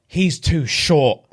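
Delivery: he's too short drawn out slowly; slow deadpan he's too short; slow blunt verdict. Retold or imitated. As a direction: deliver the rejection slowly, deadpan. Girl's blunt rejection retold.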